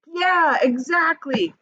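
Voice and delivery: high, loud keen yeah; mock-cheery agreeing; a kid showing how agreeing sounds; eager agreement. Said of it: "We'll be like".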